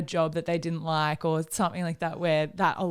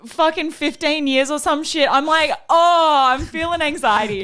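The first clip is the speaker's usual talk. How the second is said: high, loud laughing panic at the ringtone; laughing anxiety; 7 semitones higher, 8 dB louder than usual. Real, not acted. Hearing the old swim alarm, laughing loud and high. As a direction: she laughs it off, louder and higher.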